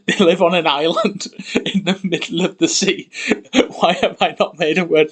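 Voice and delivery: amused disbelief at forgetting a word, laughing. Real, not acted. Creating a conlang, laughing at forgetting sea.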